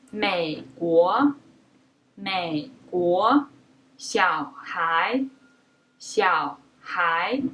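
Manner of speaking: 美国 and 小孩 with the first syllable very low, then rising; low half third tone. Shown as a demonstration. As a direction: start way down low, then rise. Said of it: "a very low falling tone".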